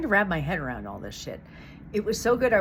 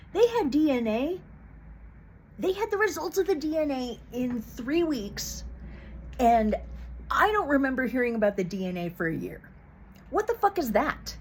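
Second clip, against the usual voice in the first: outraged; 6 semitones higher than usual. Real, not acted. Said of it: "I'm really mad".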